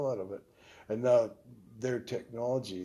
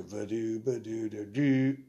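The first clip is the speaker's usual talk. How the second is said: a slow, louder scatted sax riff that lands on the wrong note; 4 dB louder, slower than usual. Retold or imitated. Sax player shows the prank note he played, louder and slower. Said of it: "I'd hit a C".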